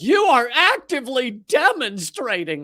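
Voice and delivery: hammed-up cop on a bullhorn; imitating a megaphone. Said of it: "He's got a bullhorn out".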